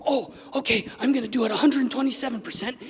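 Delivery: mock gung-ho, all in; eager resolve. Someone suddenly deciding to go all in, mock gung-ho.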